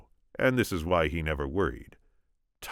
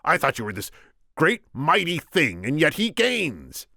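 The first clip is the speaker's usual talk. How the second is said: scornful shout; 9 semitones higher, 5 dB louder than usual. A narrator's reading. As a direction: berate the giant at full voice. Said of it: "the Sicilian shouted".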